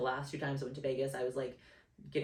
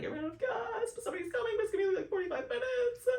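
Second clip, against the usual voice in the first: high-pitched wailing retelling of tearful panic; hammed-up tearful whine, very high; mock crying; 3 dB louder, faster than usual. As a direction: squeal it out as if sobbing. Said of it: "I'm, like, crying".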